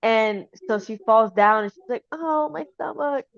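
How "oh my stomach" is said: high little wail of pain; lightly hammed-up hurt; mock pained cry. Voicing the wife hurt after falling.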